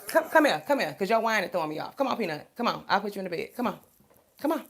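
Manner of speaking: high coaxing pet voice, calling come on; calling the dog. Real, not acted. Coaxing her dog Peanut to bed, high sing-song call.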